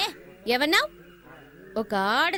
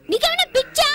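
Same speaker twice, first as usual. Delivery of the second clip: indignant outburst; 11 semitones higher, 4 dB louder than usual. Acted. Daughter shocked at father.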